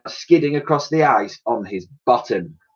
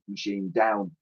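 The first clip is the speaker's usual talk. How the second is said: ordinary delivery, nothing marked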